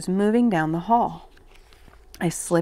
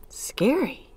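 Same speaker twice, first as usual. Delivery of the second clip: higher, softer, breath-filled question; hushed child's cry; 6 semitones higher, 6 dB quieter than usual. A narrator's reading. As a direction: whisper it up high like an awed child. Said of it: "cried George".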